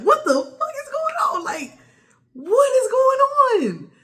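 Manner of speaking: high, loud bewildered cry; squealed, stretched-out disbelief; shocked outburst. Retold or imitated. Retold shocked outburst in story.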